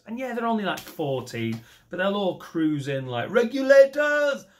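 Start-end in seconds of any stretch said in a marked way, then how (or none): 3.3-4.4 high, loud hip-hop rallying call, slow; hammed-up gangsta cry. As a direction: call the crew like a rap hook. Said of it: "the [name] reference".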